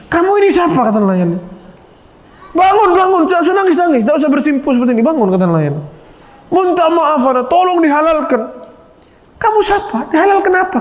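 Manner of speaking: puzzled, urging him to get up; confused fisherman. Retold or imitated. Fisherman baffled by the kneeling man, high and bewildered.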